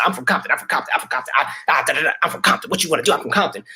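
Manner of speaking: lightly voiced brash reality-show girl, chanting her hometown; mock tough girl from Compton. Mocking a reality-show girl who keeps bragging about Compton. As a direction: brag it like a broken record. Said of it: "kept talking about Compton the whole episode".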